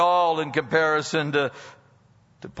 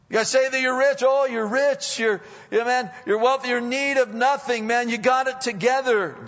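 much higher, mocking praise; mock them as if you believe it; preacher mocking proud congregation sarcastically; sarcastic; 12 semitones higher, 6 dB louder than usual. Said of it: "that's the sarcasm here".

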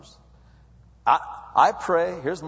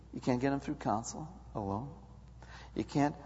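ordinary talk

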